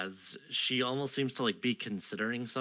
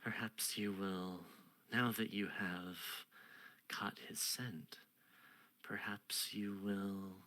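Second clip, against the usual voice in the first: soft queen; 2 semitones lower, 7 dB quieter than usual. Acted. Queen murmurs to the knight. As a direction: lean in and hush it.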